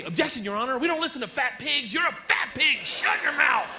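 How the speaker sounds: high, mounting courtroom outburst; outraged objection. Retold or imitated. Voice in his head objecting like a lawyer, outraged. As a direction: object high, building to a shout.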